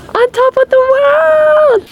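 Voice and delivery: excited. Real, not acted. Reaching summit, exclaims.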